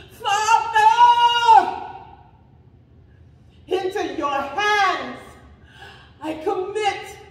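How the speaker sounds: high, forceful cry to heaven; loud call. A narrator's reading. Jesus calling to his Father before his last breath, loud cry. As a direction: call out at full voice, phrase by phrase. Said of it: "Jesus called out in a loud voice".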